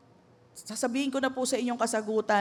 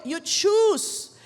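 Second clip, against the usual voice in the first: ordinary talk, nothing marked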